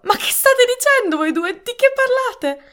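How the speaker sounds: loud, very high-pitched demand; frantic wife's high shout; high cry. A narrator's reading. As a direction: cry out in bewildered alarm. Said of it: "Dinah gridò".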